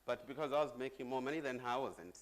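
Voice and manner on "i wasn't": ordinary talk, nothing marked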